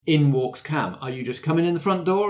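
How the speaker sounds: usual speaking voice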